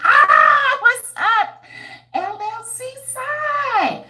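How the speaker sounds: high, loud delighted hello; excited greeting. Real, not acted. Welcoming a viewer on the livestream, excited. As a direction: sing out a greeting to a fan.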